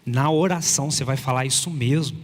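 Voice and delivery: usual speaking voice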